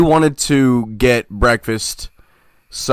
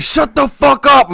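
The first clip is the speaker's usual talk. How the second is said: high, loud imitating shout, drawn out slowly; retold angry yell; 4 dB louder, slower than usual. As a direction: yell it again like the fed-up roommate. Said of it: "He's like".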